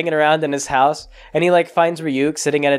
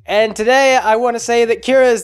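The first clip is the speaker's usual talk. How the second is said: mock press announcement; 5 semitones higher than usual. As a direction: announce it grandly from a stage, pitched up. Mock press speech on stage.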